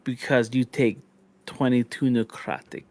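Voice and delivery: normal talking voice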